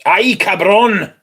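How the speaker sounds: high, loud, slow, hammed-up Spanish cry; mock Spanish exclamation. Reacting to a new perfume in mock Spanish. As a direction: belt it out like a cartoon Mexican.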